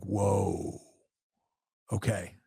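soft, hushed, half to himself; a quiet, awed realization; hushed awe. Retold or imitated. Son recalling seeing his detective dad at work, hushed awe.

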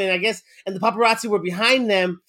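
ordinary talk

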